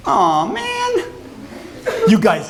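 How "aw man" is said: very high mock whine; dismayed whine. Student summoned to the professor's office, high dismayed whine. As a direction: whine it like you're in trouble.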